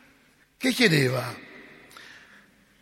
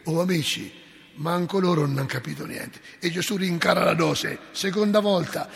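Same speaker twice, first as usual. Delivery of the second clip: ordinary delivery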